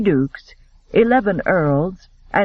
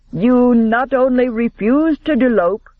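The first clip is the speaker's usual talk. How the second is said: higher, louder, words bitten off; bitten-out anger; 6 semitones higher, 3 dB louder than usual. A narrator's reading. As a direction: spit it at him. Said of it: "bit out".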